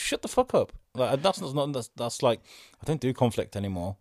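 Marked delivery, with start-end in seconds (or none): 0.0-0.7 telling off. Retold or imitated. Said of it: "was just".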